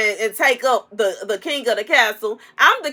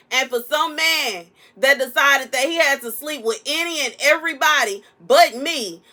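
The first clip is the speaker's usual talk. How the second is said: ordinary talk, nothing marked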